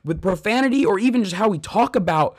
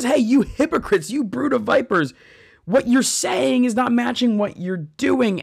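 retold rebuke; 8 semitones higher than usual. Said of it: "even the manner he's saying it".

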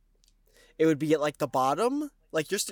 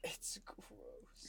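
hushed whispered disgust; 21 dB quieter than usual. Pretend. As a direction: whisper the word like a secret. Whispered echo.

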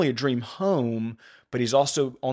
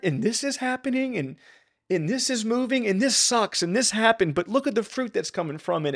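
fighting back real emotion, pitched up; emotional; 9 semitones higher than usual. Real, not acted. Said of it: "I'm getting emotional about it".

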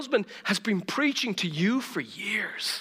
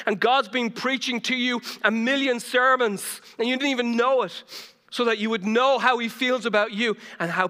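preaching at full force; 5 semitones higher, 4 dB louder than usual. Real, not acted. Preaching builds.

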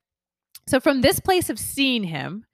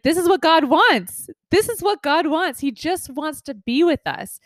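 sudden realization; 7 semitones higher than usual. Retold or imitated. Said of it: "And I was thinking, like".

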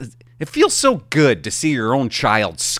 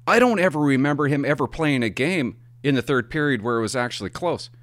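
normal talking voice

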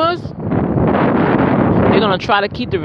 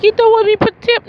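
high, louder quoted question from the manicurist; nail lady's question; 13 semitones higher, 4 dB louder than usual. Retold or imitated. Nail lady offering tips, high louder question. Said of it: "She said".

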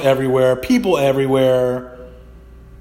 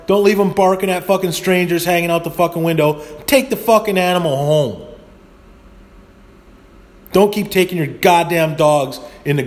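raised, heated scolding of dog owners; berating people who bring dogs into the store; angry rant; 5 semitones higher than usual. Real, not acted. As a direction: tear into the listeners, voice up. Ranting at dog owners listening.

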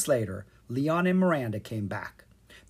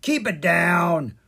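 slow, raised grumpy frog voice; louder, slow croaky frog; croaking frog; 5 semitones higher, 7 dB louder, slower than usual. A narrator's reading. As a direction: croak like a sleepy annoyed frog. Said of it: "one of the frogs croaked".

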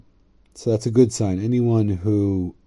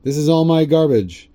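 proud declaration; 7 semitones higher, 7 dB louder than usual. Retold or imitated. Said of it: "V'amar, and he said".

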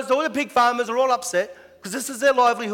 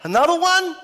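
much higher, incredulous "Another one?"; exasperated; 10 semitones higher, 4 dB louder than usual. Retold or imitated. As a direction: groan it at yet more roadworks. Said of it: "It's like".

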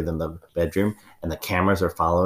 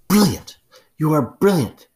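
delighted exclamation; 10 semitones higher, 6 dB louder, slower than usual. Acted.

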